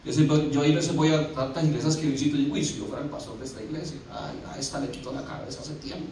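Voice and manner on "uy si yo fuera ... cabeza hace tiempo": low, quiet private aside; thinking aloud. Retold or imitated. Visiting pastor privately judging the church's members, muttered aside. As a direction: mutter it quickly to yourself, much softer.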